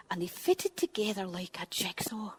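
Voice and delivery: hushed whispered narration. A narrator's reading. Storyteller to the children, hushed whisper. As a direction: drop to a whisper.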